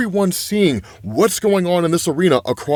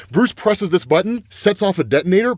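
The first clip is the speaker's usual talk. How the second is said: usual speaking voice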